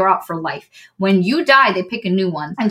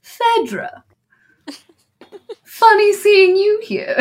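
smug greeting; 12 semitones higher, slower than usual. Retold or imitated. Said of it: "she's like".

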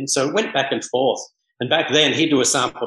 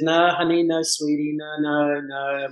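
Caribbean switchboard lady; 3 semitones higher than usual. Retold or imitated. Switchboard lady turning down a small rum caller, drawn-out sing-song refusal. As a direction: imitate a sweet-talking receptionist refusing on a held note. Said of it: "the Caribbean sort of feel".

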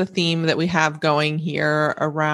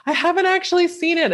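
much higher, fast, stressing "actually"; high excited confession; 13 semitones higher, faster than usual. Real, not acted. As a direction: blurt "I haven't actually seen it" high and quick. Admits never seeing Hamilton.

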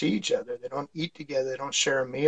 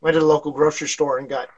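ordinary talk, nothing marked

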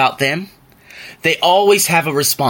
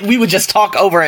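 ordinary talk

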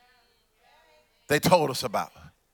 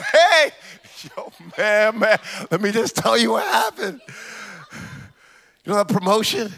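bragging friend. Retold or imitated. Friend bragging about promotion.

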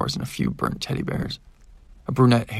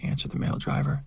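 ordinary delivery

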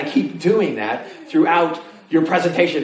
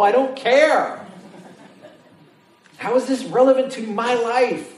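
bored whiny student; 9 semitones higher than usual. Retold or imitated. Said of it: "frustrated student voice".

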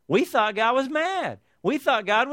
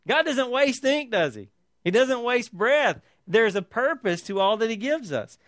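normal talking voice

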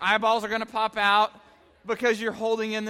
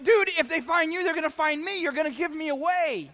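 higher, pleading complaint; mock exasperation; 7 semitones higher than usual. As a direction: beg him not to ruin it. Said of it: "I'm like".

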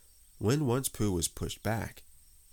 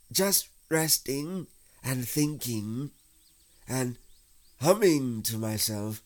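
high, slow, drawn-out nonchalance; careless; 6 semitones higher, 4 dB louder, slower than usual. A narrator's reading. Stuck Pooh pretends he is resting, careless. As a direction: stretch it out as if nothing were wrong. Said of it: "said Pooh carelessly".

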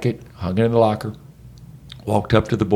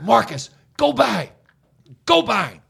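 coaching yell; 10 semitones higher than usual. Retold or imitated. Coach yelling at players in drill.

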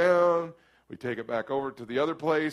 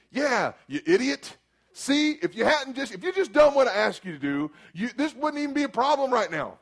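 higher, exasperated inner scolding; inner angry rant; 8 semitones higher than usual. Retold or imitated. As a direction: scold them in your head. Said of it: "So now I'm thinking".

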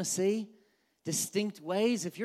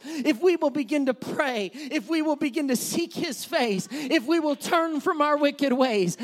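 raised, insistent preacher's appeal; full-force preaching; 4 semitones higher, 5 dB louder than usual. Acted. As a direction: urge the church toward baptism. Preaches an altar invitation.

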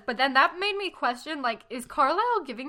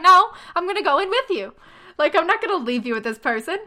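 higher, loud insisting friend; protective shout; 5 semitones higher, 7 dB louder than usual. Retold or imitated. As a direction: stand your ground loudly.

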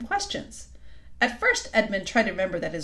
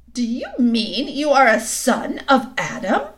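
intense queen; 4 semitones higher, 7 dB louder than usual. A narrator's reading.

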